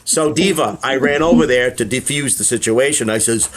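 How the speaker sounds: ordinary delivery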